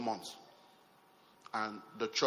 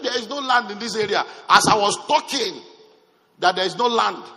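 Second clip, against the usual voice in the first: loud, higher firm no; emphatic refusal; 7 semitones higher, 10 dB louder than usual. Retold or imitated. Pastor telling buyers no land, loud firm refusal. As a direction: shut it down firmly and loudly.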